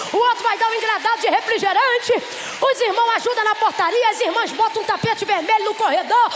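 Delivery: loud, high, laughing list of preparations; excited, laughing rush. Acted. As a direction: pile it on with joyful energy. Igreja toda prepara o casamento. (empolgação alta e risonha)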